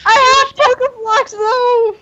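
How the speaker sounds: very high squeaky cry; excited squeal. Real, not acted. Player squeals that she has Pokeblocks, very high and excited. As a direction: squeal it with delight.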